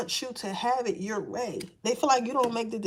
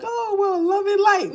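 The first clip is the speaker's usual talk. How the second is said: higher, louder mock sweet angel voice; hammed-up angelic sweetness; angelic spirit; 9 semitones higher, 6 dB louder than usual. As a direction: gush it like a saintly angel. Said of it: "you would think they sound like".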